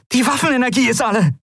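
fast, very high-pitched outburst; hissed alarm. A narrator's reading. Pilot reporting dead weapons, hissed alarm. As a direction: rush it out high and urgent. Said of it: "zischte Endriel".

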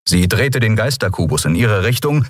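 usual speaking voice

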